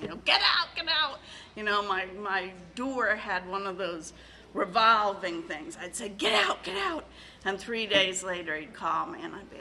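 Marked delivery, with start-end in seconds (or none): 0.3-1.2 high, loud, half-laughing get out; a retold high, loud yell of get out; mock yell. Retelling throwing partner out. 6.1-7.1 high, loud, half-laughing get out; a retold high, loud yell of get out; mock yell. Retelling throwing partner out.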